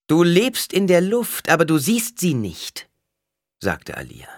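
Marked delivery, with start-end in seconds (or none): 0.1-2.9 high, loud rebuke; sharp. A narrator's reading. Alia rebukes the wailing mother, sharp-voiced. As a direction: cut her down with a raised voice. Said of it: "Schärfe in der Stimme".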